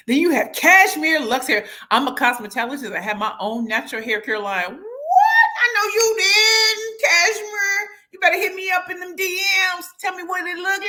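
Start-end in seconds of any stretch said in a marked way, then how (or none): 4.8-10.8 high, loud, sung-out hype; excited sing-song. Real, not acted. Hyping Cashmere's hair line.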